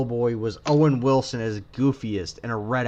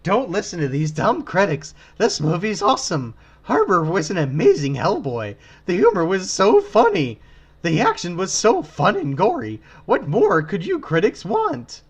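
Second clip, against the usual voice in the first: high, loud gushing superfan; mock a starstruck fan review; 6 semitones higher, 6 dB louder than usual. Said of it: "I have to read this one".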